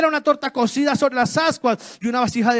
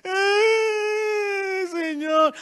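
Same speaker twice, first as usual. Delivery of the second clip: high, hammed-up wailing cry; mock crying wail; 5 semitones higher, much slower than usual. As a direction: sob it out like a self-pitying prophet. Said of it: "como estaba Elías allá". (lamento fingido y llorón de Elías)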